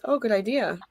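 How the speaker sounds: normal talking voice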